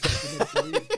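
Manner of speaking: giggling in disbelief; laughing. Real, not acted. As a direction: laugh through the words.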